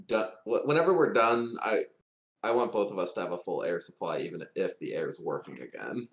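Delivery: ordinary delivery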